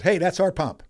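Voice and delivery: a mildly voiced old lady hollering; calling out from the car. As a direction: claim the pump across the forecourt. Driver yells from car window.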